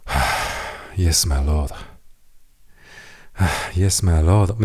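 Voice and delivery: grudging yes with a weary sigh; reluctant sigh. Acted. Servant answering a lazy king's massage order, reluctantly. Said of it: "僕やったらですね".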